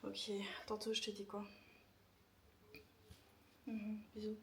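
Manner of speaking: whispering. Real, not acted. Whispering on scared phone call.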